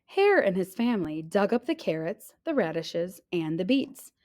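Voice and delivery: ordinary delivery, nothing marked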